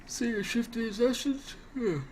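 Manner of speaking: talking through a yawn. Real, not acted. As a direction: stretch the words in a yawn. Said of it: "I don't know why I'm yawning so much".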